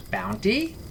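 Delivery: high, bright, rising "Bounty?"; bright eager question. Acted. Salas perks up hearing of a bounty, bright and eager. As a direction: perk up at the word. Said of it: "Salas asked brightly".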